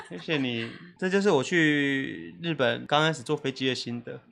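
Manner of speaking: normal talking voice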